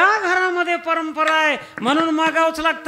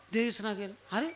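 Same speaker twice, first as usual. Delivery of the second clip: ordinary talk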